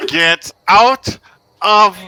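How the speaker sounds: high, loud, word-by-word order; emphatic urging. Acted. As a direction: punch each word to get them moving. Urging party to leave dragon's tower.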